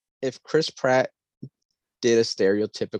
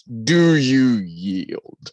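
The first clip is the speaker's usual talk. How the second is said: slow, drawn-out penguin king demand; Kari Payton; 4 dB louder, much slower than usual. Retold or imitated. Quoting Kari Payton's penguin king trailer line, slow booming-king imitation. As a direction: stretch it out like a ruler demanding surrender. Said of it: "as the king of the penguins".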